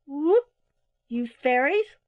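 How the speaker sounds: high, loud owl call; crying out. A narrator's reading. Mother Owl at the fairies' window, loud cry. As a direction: whoop it out at the fairies. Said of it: "she cried".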